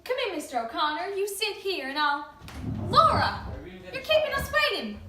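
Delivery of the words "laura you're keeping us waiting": loud call across the house. Acted. Mother calls daughter from room.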